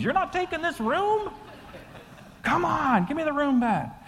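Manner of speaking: put-on high-pitched protesting old-timers; mock revolt. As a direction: imitate indignant elderly rebels in a high voice. Acting out old people's comic revolt.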